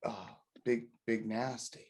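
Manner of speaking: softly owning up, retold; sheepish admission. Husband confessing to his wife who he was with, sheepish. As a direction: drop the volume for a reluctant mock confession.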